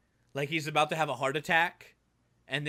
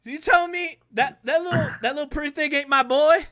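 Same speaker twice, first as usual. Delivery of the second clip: high, loud incredulous demand; a father aghast at the betrayal; 11 semitones higher, 5 dB louder than usual. Acted. Father voiced in game scene.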